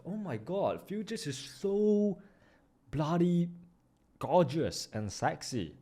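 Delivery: dreamy gushing. Real, not acted. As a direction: savour every word like a crush. Gushing over futures.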